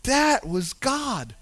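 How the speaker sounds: slow, soft, wondering; slow hushed awe. Pretend. Believer looking back, slow and hushed. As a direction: draw it out gently in wonder. Realization in hindsight.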